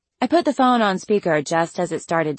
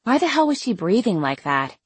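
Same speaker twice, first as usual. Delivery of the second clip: plain reading voice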